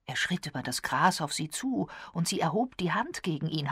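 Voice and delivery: usual speaking voice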